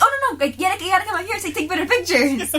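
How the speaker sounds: high, fast, pushy hero voice; mock eager hero. Darkwing pulling the conductor into the photo, high and fast. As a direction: rush him into posing for the camera.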